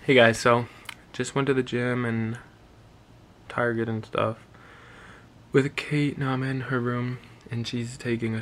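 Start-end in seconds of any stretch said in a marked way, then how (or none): none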